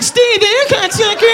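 tiny high, slow doughboy voice. Retold or imitated. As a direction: squeak it out like a little dough creature. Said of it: "The Pillsbury Doughboy's like".

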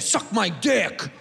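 slow, drawn-out hostile jab; negative, as an insult. Shown as a demonstration. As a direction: drag it out as a put-down. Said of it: "We say it negative".

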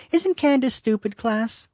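mocking singsong teacher voice; high mocking teacher. Mock teacher asks empty chairs.

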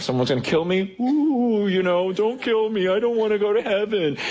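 hammed-up whimper of a scared believer; high, mocking panicked plea; mock fear. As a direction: play the coward for a laugh. Mocks a fearful believer.

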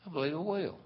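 high, soft put-on reply; dainty, acted yes; accepting Eloise. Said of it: "And she said".

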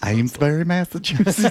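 teasing Southern-belle accent imitation; mock foppish accent. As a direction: mimic a dandy's accent, rising high at the end. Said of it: "a foppish dandy".

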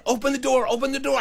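high-pitched urgent pleading; frantic demand. Retold or imitated. Emily to boy at the door, frantic demand. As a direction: imitate a panicking girl.

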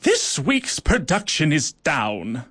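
slow, commanding queen's bark. A narrator's reading. Ant queen scolding her colony at a meeting, barked slowly. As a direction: lay down the bad news like a stern ruler. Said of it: "she barked".

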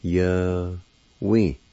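"Ye-we" said slowly as two clear syllables, ye then we; careful teacher's syllable demo of "Ye-we"; Cherokee word. Shown as a demonstration. Teacher showing learners how "Ye-we" sounds, carefully. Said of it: "It's pronounced like this".

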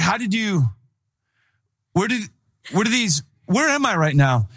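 confused stammering question; bewildered. Retold or imitated. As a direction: stammer it out, totally thrown. Bewildered in the chop shop.

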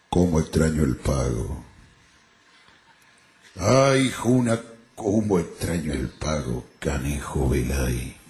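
low, slow, drawn-out gaucho lament; lamenting drunk criollo. Acted. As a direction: lean on the bar and moan it long and low. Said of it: "Estaba un criollazo en un boliche".

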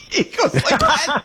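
high, helpless laughter through the words; laughing. Real, not acted. Host laughing to his guest at the punchline, breaking up. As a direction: crack up as you finish the story.

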